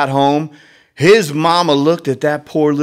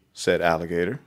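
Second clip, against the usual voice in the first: plain reading voice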